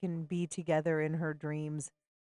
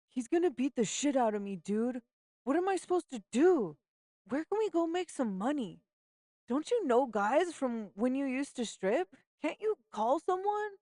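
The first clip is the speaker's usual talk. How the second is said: put-on high, pleading girlfriend voice, rising in panic; a scared teen begging for a way out; Simone, panicked; 8 semitones higher than usual. Simone begs her friend to find money tonight, high frantic plea.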